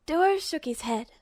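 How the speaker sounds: ordinary delivery, nothing marked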